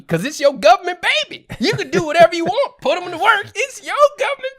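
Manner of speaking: hammed-up politician at full volume; rally shouting. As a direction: yell it to a cheering crowd. Climax of mock campaign.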